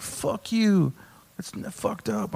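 mock a tearfully upset audience member; crying mad, imitated. Upset man at stage right to comic, mock crying mad. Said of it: "he was like crying mad".

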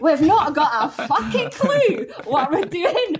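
put-on laughing through an Irish accent. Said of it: "Can I make her Irish?".

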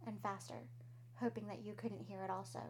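normal talking voice

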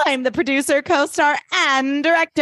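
mock grand declaration; self-important announcement. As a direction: list your titles grandly, imitating a diva. Acting out Blair taking over the show.

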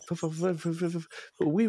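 high hammy fake French babble; mock French gibberish. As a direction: ham up nonsense French syllables. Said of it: "what a couple of idiot Americans sound like trying to speak French".

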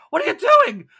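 high, loud scream of outrage; screaming vendor. Acted. The cabbage seller screams at the players who knock into his cart. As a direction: shriek it at the runners. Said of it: "he's screaming".

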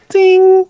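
high loud ding; door chime. Pretend. Mimics door chime at Starbucks.

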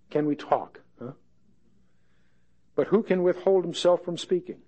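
a lightly voiced coaxing friend; gentle Eliphaz. Eliphaz coaxing Job to listen, lightly voiced gentle friend. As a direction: ease into it, asking permission. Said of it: "He's starting delicately, gently".

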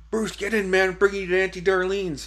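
high-pitched imitation of a rescuer; urgent calling. Retold rescuer urging him into car.

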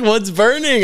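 high, loud mock mom question; imitated suspicious mom. Mom sniffing out the smoke, imitated shrieky demand. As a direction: play the mother demanding an answer. Retold mom confronting the boys.

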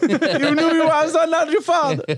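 high, drawn-out put-on father voice; mock estranged father. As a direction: play a hammed-up dad's accusing phone call. Acts estranged Greek father calling in.

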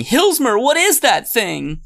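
put-on high, frightened kid voice; scared little boy. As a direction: squeak it out like a spooked little boy. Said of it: "the young boy me is saying".

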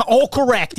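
thick Boston "All correct", aah for all, r dropped; Boston accent. Retold or imitated. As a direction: say it like a real Bostonian. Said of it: "I'm from Boston".

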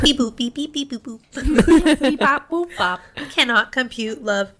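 playful beeping robot noises. Pretend. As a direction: chirp beeps like a toy robot. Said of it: "I am a robot".

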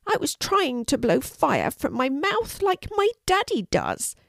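high, small, sorry voice; high-pitched childlike dragon, a touch sad; sad little dragon. A narrator's reading. Norman admits failure to Kelty, sad little dragon. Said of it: "said Norman sadly".

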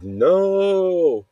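a high, loud, stunned no; shocked no. Retold or imitated. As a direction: cry out no as the work is smashed. Monster sees his companion destroyed.

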